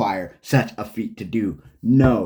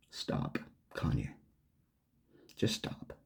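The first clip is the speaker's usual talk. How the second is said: whispered plea; 13 semitones lower, 15 dB quieter, slower than usual. Real, not acted. Pleading at end of rant.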